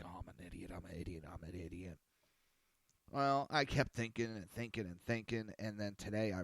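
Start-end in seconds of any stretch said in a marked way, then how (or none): 0.0-2.0 muttered self-scolding. Retold or imitated. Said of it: "I'm like".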